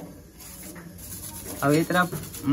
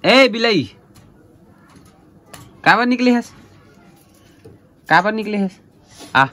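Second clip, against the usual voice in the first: calling the cat loudly, higher; raised voice calling a pet; 5 semitones higher, 5 dB louder than usual. Real, not acted. (बिल्ली को ऊँची आवाज़ में पुकारना)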